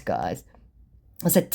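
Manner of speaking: usual speaking voice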